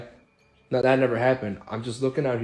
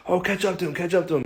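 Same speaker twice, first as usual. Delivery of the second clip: much higher "catch up to him"; egging on; 7 semitones higher than usual. Retold or imitated. Friends yelling at the driver to chase the car, urgent. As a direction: urge the driver on.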